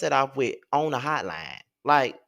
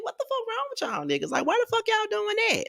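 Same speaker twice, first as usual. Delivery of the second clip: much higher, exasperated disbelief; shrill-free high pitched what-the-hell; incredulous reaction voice; 4 dB quieter than usual. Retold or imitated. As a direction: replay your stunned reaction up high. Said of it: "I'm just like".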